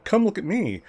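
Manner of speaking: high, sing-song mock announcement; mocking whiny voice. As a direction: mimic yourself bragging in a whiny tone. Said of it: "starting to bitch about how".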